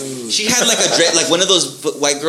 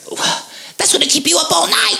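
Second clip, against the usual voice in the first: imitating the barista, pitched way up; high mock barista voice; 13 semitones higher than usual. Barista shocked at his espresso order, high mock voice. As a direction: squeak it out like the shocked barista girl. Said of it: "she's just like".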